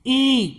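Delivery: ई stretched into a long 'eee'; long ee sound. Shown as a demonstration. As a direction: hold the vowel ई long. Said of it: "लंबा sound".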